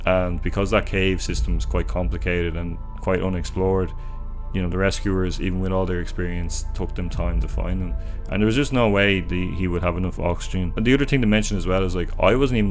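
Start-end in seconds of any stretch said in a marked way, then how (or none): none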